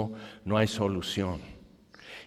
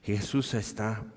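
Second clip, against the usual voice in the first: ordinary delivery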